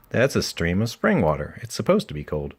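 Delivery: plain reading voice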